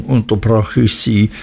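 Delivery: usual speaking voice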